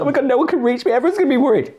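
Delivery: high-pitched, hammed-up panic; mock panic. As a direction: squeal it like a drama queen.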